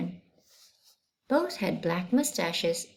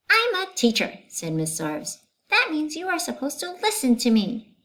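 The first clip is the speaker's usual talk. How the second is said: high, loud, huffy teacher voice; a frazzled teacher demanding respect; indignant teacher; 9 semitones higher, 4 dB louder than usual. Acted. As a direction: schoolteacher scolding children, high and indignant. Teacher Miss Zarves voiced.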